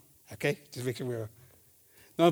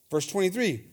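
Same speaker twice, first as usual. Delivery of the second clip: ordinary talk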